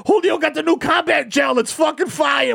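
high hyped shout; hyped roach. Acted. Roach son hyped about combat gel, high shout. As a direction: brag it like a kid on a high.

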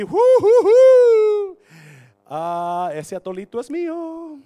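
very high, slow cooing laugh then loud claim; predatory seductress. Acted. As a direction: purr like a cougar eyeing prey. Esposa de Potifar seductora. (esposa de Potifar, risita aguda y seductora)

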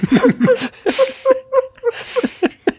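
high burst of laughter; big laugh. Real, not acted. Host cracking up after his silly impression, loud laugh. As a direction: crack up at yourself. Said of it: "I don't know what just happened here".

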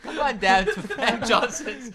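infomercial. Retold or imitated. Mock ad for pool gas.

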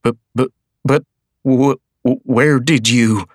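high, loud, stumbling stammer. A narrator's reading. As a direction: trip over the words in shock. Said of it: "Rian stammered".

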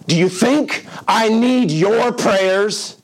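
high, very slow, offended sneer; indignant retort. Retold or imitated. Frail patient snapping at the preacher, high slow indignant voice. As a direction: spit it back in his face. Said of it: "gave it to me face to face".

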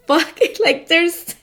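high-pitched laughing "what the"; recreating a disbelieving laugh; retold laughing disbelief. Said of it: "laughing, you guys".

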